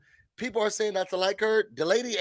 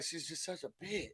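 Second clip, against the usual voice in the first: hushed, breathy gossip aside; whispered; 18 dB quieter than usual. Retold or imitated. Luann whispering to Kenya downstairs, hushed. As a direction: say it under your breath. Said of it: "She's like".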